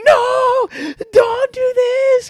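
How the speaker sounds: high, loud, slow panicked scream; screaming plea. Retold or imitated. Hacked scammer on speaker, imitated panicked screaming. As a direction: beg frantically, voice way up. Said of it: "just, like, screaming".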